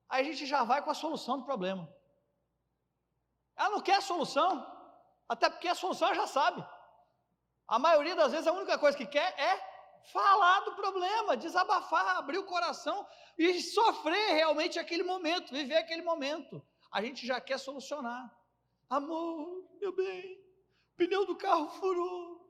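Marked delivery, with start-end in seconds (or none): 3.6-16.6 higher and louder, fervent; passionate preaching. Acted. Preacher to husbands about wives, fervent and loud. 18.9-22.5 higher, cooing wife voice; sweet wife. Retold or imitated. Wife telling husband the tire burst, higher cooing.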